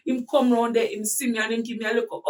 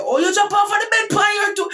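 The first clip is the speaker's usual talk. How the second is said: high, loud scoffing mother; mock the excuse, loud and high; mother scoffing at the bed excuse; 9 semitones higher, 5 dB louder than usual. Mother scolding in disbelief.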